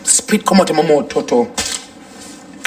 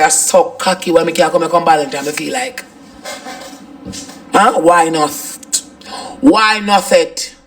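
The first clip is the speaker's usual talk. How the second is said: normal talking voice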